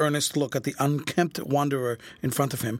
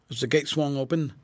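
ordinary delivery, nothing marked

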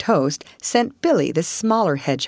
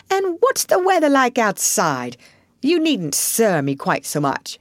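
lightly voiced Rat, higher on the question; brisk questioning; 7 semitones higher than usual. Rat asks hedgehog about the weather, brisk. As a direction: ask it briskly, lifting the pitch.

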